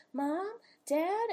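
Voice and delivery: put-on high, plaintive calls of a scared child; lightly voiced little kid calling; calling to missing parents. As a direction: call out for Mom and Dad, lost. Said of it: "be like".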